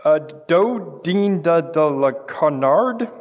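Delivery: slow, deliberate recipe title; drawn-out, careful French dish name; halting French reading. Acted. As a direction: pick through the French slowly, word by word.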